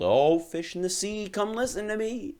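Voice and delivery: high, loud call to the sea; sing-song call across the water. Acted. Fisherman calls out over the sea to the fish, high loud call.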